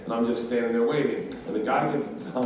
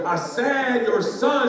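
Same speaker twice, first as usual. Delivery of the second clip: very slow, high, drawn-out words; exaggeratedly slow, patronizing repeat; slowed down for lip-reading; 11 semitones higher, 4 dB louder, much slower than usual. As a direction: stretch each word as if for a lip-reader. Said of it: "Slow it down. She reads lips".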